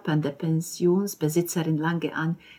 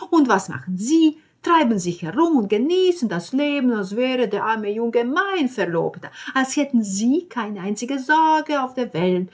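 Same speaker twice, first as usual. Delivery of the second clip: higher, louder nagging Italian landlady; scolding landlady; 4 semitones higher, 6 dB louder than usual. Acted. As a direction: rant at her, higher and louder, indignant. Landlady's long scolding rant.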